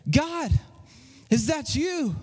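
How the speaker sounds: tentative calling out. Acted. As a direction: call up uncertainly to God. Woman calls out to the voice.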